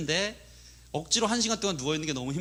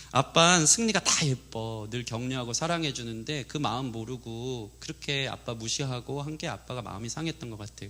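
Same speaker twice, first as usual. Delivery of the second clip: patiently explaining mother; 5 semitones lower than usual. Retold or imitated. Mother explaining dad's hurt to son, low tender reassurance. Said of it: "엄마가 이제 성령님과 같기 때문에 잘 설명해줘요".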